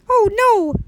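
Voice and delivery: high, drawn-out wail of dismay; hammed-up villagers crying out in alarm; mock dismayed cry.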